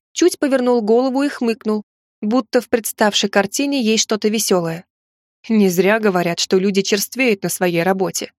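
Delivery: ordinary delivery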